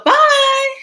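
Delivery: high, loud, stretched-out farewell; breezy hollered send-off; called-out goodbye. Retold or imitated. Calling goodbye to her friend.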